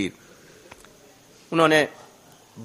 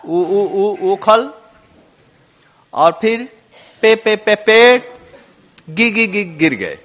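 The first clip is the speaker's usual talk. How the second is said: put-on halting child stammer on each word; stammering baby talk; slower than usual. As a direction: stumble over syllables like a toddler. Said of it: "तुतलाते हुए बोलने लगे". (हकलाती तुतलाती बच्चे की बोली)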